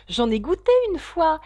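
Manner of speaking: a loud, high slip of the tongue; blurted out. A narrator's reading. Alice laisse échapper un aveu. (lâché d'un coup, plus fort et plus aigu)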